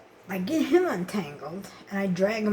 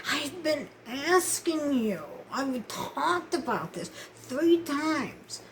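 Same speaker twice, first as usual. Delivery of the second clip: high, slow, drawn-out complaint; retold exasperated pushback, pitched up; exasperated protest; 6 semitones higher, slower than usual. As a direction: stretch each word out in pleading frustration. Protesting to landlady about the bill.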